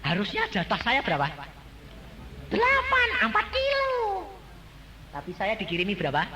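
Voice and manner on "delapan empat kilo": high, loud exclaiming; exclaimed answer. Acted. Preacher quizzing congregation on gold share, high excited exclaim. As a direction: fling the answer out to the crowd.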